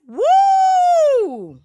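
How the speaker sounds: high loud woo; excited whoop. Pretend. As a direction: cheer him on hard. Hyping Barack's new look.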